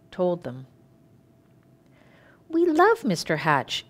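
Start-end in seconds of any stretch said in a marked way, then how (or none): 2.5-3.9 higher, insistent; insisting. A narrator's reading. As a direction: press the point that they love him. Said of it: "insisted Mister and Missus Dunwoody".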